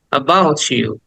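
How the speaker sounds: "about you" as "abouchu"; t-y blended to ch. Shown as a demonstration. Teacher repeating "about you" with the ch blend. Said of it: "চয়ের মতো উচ্চারণ করে".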